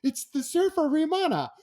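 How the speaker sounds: high, excited call to the other room; calling out to the wife in disbelief; telling his wife the surfer is on the line, high and excited. Retold or imitated. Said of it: "I yelled at my wife".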